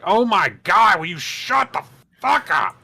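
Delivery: high, exasperated demand; mock fed-up outburst; exasperated yell. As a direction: finally lose patience. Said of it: "And I'm just like".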